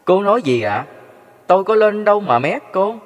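chồng hỏi lại vợ, giọng cao và to hơn; cất cao giọng phân bua, ngỡ ngàng; ngơ ngác, không hiểu. A narrator's reading. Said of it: "chồng cô không hiểu cô nói gì". (giọng ngơ ngác cãi lại)

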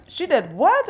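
high, startled question; shocked disbelief. Retold or imitated. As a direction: react in disbelief. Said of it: "Jimmy is like".

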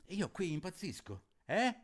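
a touch of frantic anguish; mild angry cry. Acted. Young partisan complains to his father, mild anguished cry. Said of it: "urlò suo padre in irosa angoscia".

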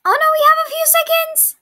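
high fast panic; rushed alarm at the clock; panicked. Real, not acted. Timer running out.

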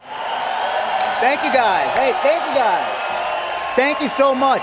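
slow, loud shouted thanks to the crowd; milk the applause like a rock star; mock star thanks.

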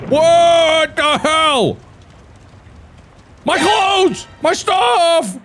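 high, drawn-out what the hell; shocked dismay. Real, not acted. Player finds all his belongings burned, high shocked dismay. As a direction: squeal at the ruined stuff.

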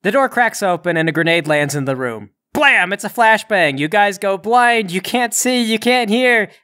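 game master building to a high, excited pitch; dramatic scene narration. A narrator's reading. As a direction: drive the action home, rising with each blow. Game master narrates a flashbang attack.

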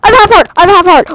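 loud, high alarmed cry; panicked cry. Real, not acted. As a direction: shout it in genuine alarm. Nearly dead in game.

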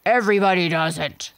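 loud, gruff and cross; angry voice. Acted. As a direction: snap it back in anger. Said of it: "said the angry voice".

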